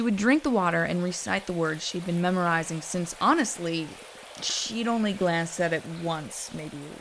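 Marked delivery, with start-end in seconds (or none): none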